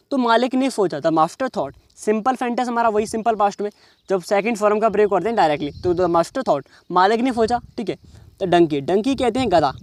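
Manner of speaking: ordinary delivery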